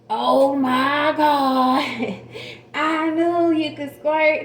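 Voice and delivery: mock thrilled husband exclaiming; excited husband. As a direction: drag out his thrill. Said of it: "And he said".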